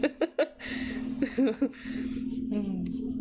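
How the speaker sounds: long giggling fit, high; laugh. Real, not acted. Crafter being honest about her looks, laughing. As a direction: keep giggling until it trails away.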